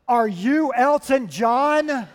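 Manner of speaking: imitating a starstruck fan blurting it out loudly, words stretched; nervous, too loud. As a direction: blurt it far too loud and slow, as if nerves took over. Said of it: "the volume of her voice starts to grow".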